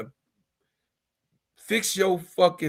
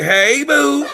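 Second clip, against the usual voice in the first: higher, louder playful "hey boo" with a laugh; imitating a friend's greeting; 10 semitones higher, 11 dB louder than usual. Streamer imitating a friend greeting him, higher and louder with a laugh. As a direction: toss it out like a friend calling from the chat, laughing. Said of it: "A D P say".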